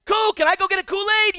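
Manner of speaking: put-on high, oblivious little-kid voice; little daughter. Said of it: "And she's like".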